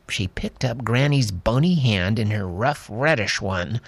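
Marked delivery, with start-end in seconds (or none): none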